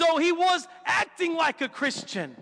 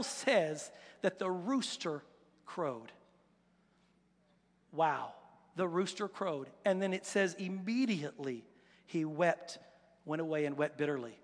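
lower, softer, with grave pauses; hushed, sorrowful; 9 semitones lower, 9 dB quieter than usual. Real, not acted. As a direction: let it sink into a subdued hush. Solemn telling of Peter's bitter weeping.

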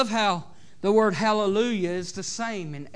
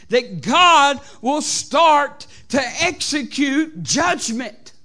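loud, raised preaching voice; fervent preaching; 7 semitones higher, 7 dB louder than usual. Acted. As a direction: drive each phrase home to the congregation. Preaches at full force.

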